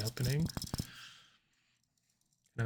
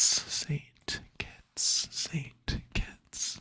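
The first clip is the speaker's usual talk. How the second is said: breathy whisper, name said over and over; 4 semitones higher, much slower than usual. Shown as a demonstration. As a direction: whisper it like a secret. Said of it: "repeats the name in a whisper".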